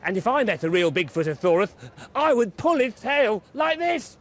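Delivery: high, loud cheeky boast; Baby Kong showing off, high and loud; Baby Kong bragging. A narrator's reading. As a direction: cry it out like a bold toddler. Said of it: "cried Baby Kong".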